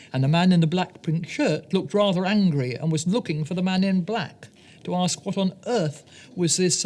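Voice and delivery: ordinary delivery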